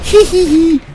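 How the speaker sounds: very high put-on tee-hee; squeaky taunting giggle; mock giggle. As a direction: giggle like a cartoon imp.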